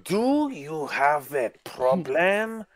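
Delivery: slow, heavy mock Spanish accent. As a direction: drag it out like a bad Spanish-accent wrestler promo. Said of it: "comedic fucking version of a Spanish, accent".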